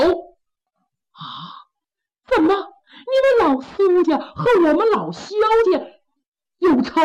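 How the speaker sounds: high, half-crying disbelief; shocked wife. Acted. Wife learning of the blood feud, high and shocked. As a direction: gasp it out in shock through tears.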